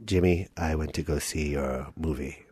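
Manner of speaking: very low, soft dad voice; father. Acted. Dad reviewing his son's movie, low and hushed. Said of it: "I'm gonna play your dad".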